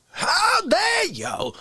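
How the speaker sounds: high slow wailing outrage; crying outrage. Acted. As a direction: wail it in hammy outrage. Performed bit outburst.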